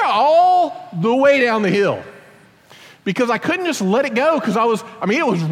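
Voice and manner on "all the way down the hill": slow, loud, high wail stretched over the descent; drawn-out yell. Retold or imitated. Father retelling the terrifying ride down the hill, slow high howl. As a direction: howl it like you're barely holding on. Riding the brake down steep hill.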